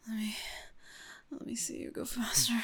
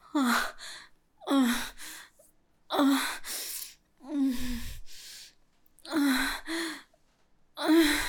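moans climbing higher and louder; rising pleasure moans; building moans; 4 semitones higher, 7 dB louder, much slower than usual. Acted. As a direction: let each moan build on the last. Moans rising during sex scene.

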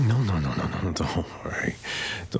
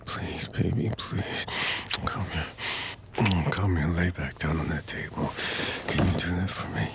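usual speaking voice

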